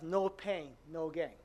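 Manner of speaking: ordinary talk, nothing marked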